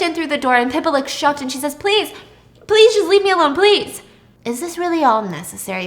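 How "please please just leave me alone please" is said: high, loud desperate begging; pleading. Retold or imitated. Pippa, cornered at work, begs the couple in a high, loud voice. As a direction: beg them to leave you alone.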